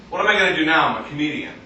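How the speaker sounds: ordinary talk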